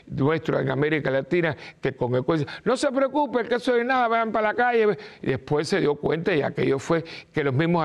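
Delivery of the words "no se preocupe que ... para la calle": mock careless president. Mocking president's careless reassurance.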